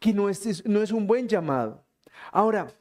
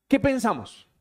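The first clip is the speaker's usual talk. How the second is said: usual speaking voice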